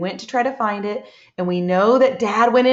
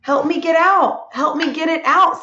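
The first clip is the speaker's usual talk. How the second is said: higher, louder storybook cry for help; calling for help; 6 semitones higher, 3 dB louder than usual. Acted. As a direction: call out like Dad stuck in mud. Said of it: "he's yelling out for help".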